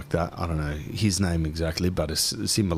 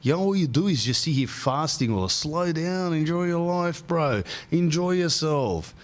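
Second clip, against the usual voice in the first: higher teasing wife voice; mock the nagging wife, pitched up; 8 semitones higher than usual. Teasing her fasting man, higher mock voice. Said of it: "She says".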